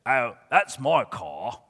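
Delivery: slow, put-on Bucknell accent. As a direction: play the weasel with a drawling Bucknell accent. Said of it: "That's a Bucknell accent".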